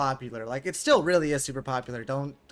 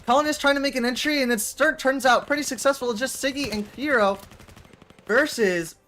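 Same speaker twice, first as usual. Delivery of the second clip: higher, louder, rising shoutcaster energy; fast esports commentary climbing to the kill; excited play-by-play; 8 semitones higher, 6 dB louder than usual. Acted. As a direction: call the round like a live caster building hype. Casting the round play-by-play.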